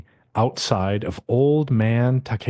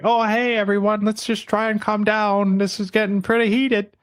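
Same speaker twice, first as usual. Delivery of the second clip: much higher, anxious calming voice; nervous peacemaker; 10 semitones higher than usual. Retold or imitated. Yoshiki trying to calm the fight, high and nervous. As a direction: squeak out a nervous plea to calm down. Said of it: "Yoshiki is like".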